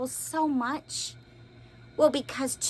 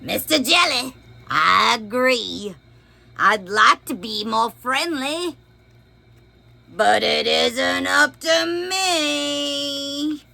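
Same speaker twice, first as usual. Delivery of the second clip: lively storybook read-aloud; expressive picture book reading; reading to children; 4 semitones higher, 10 dB louder, slower than usual. A narrator's reading. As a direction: read it big for little listeners.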